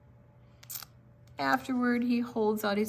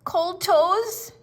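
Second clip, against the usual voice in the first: high, eager little bunny; 12 semitones higher, 9 dB louder than usual. A narrator's reading. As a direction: ask it like a small child. Bunny asking Dad.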